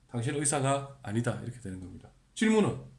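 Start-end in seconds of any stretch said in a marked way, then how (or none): none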